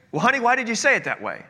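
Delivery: an impatient, harsh husband; mock irritated retort to his wife. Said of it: "talking harsh to my wife".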